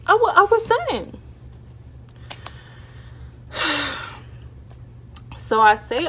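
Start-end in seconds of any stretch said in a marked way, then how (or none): 0.1-1.2 exasperated. Real, not acted. Peak of retelling. 2.8-4.8 breathy sigh. Real, not acted.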